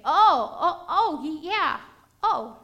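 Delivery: flustered, stuttering oh's, rising; mock shock at being asked; stammering surprise. Helper caught off guard, stammering in surprise.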